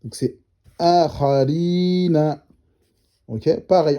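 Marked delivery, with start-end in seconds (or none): 0.8-2.5 akharina read slowly with each syllable clear; slow Arabic reading. Shown as a demonstration. Teacher modelling the correct reading. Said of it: "on dit pas akhariya".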